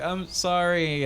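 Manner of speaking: drawn-out high apology; slow meek sorry. Retold or imitated. Extra apologizing to director, slow and pitiful.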